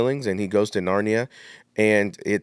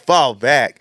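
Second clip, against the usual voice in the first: laughing; 4 semitones higher, 7 dB louder than usual. Real, not acted. Repeats with laugh.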